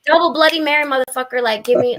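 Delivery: loud, high-pitched demand; acting out boisterous defiance; brash drink order. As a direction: belt out the order like you badly need it.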